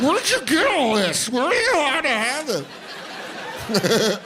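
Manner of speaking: put-on high, slow cooing baby talk; doting dog voice. Owner gushing over his dog's neck fat, high baby talk. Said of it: "I'm like".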